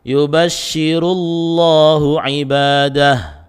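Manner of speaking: slow melodic tajwid chant; Quran recitation. Shown as a demonstration. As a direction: chant the verse slowly with heavy lam.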